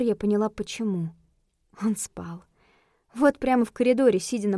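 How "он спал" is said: hushed, breathy whisper. A narrator's reading. Narrator whispering that the husband sleeps in the hall. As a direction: whisper so as not to wake him. Narrator finds Vince asleep.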